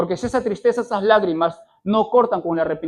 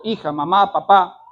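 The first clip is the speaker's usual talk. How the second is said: ordinary delivery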